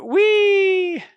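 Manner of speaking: high, loud, drawn-out "wheee"; playful whee. Pretend. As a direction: let out a long mock-gleeful squeal. Playful whee as cards untap.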